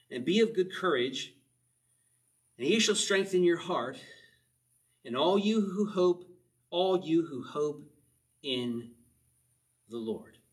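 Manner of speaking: reading scripture with rising fervor; preaching. Acted. Preacher reading the psalm aloud to his congregation with fervor. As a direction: proclaim it, building. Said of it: "What a beautiful way to end this psalm".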